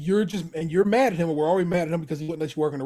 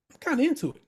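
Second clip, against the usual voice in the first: much higher, delighted little admission; pleased Cicero; 12 semitones higher, faster than usual. Retold or imitated. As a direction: squeak it out like a guilty pleasure. Cicero enjoying the quiet.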